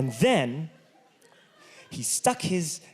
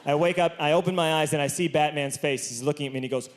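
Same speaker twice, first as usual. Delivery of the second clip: usual speaking voice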